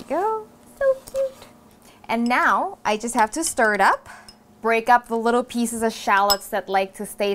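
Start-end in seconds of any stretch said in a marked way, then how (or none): 0.0-1.5 cutesy squeal. Real, not acted. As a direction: squeal it like something adorable. Cooing over tiny pepper cubes.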